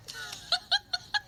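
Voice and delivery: breaking into a high giggle; high-pitched laugh. Real, not acted. Mother laughing at her daughter's notes, high giggle.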